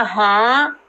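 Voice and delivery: ordinary delivery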